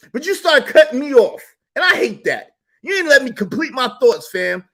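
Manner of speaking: loud high angry rant; rant, furious about interruptions; angry shouting. Real, not acted. Angry rant about being cut off.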